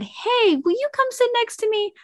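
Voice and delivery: put-on high, sing-song call to a child; high calling voice, lightly put on; mock sweet call. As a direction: coax the kid over in a bright high voice. Mom calling child over.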